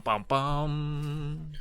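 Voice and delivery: usual speaking voice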